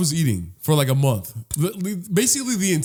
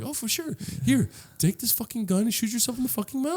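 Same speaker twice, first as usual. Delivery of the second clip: higher, softer fake-sweet sarcasm; mock cashier; 7 semitones higher, 5 dB quieter than usual. Cashier mocking a customer with six fifty. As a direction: sneer sweetly at the broke customer. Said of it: "and they're like".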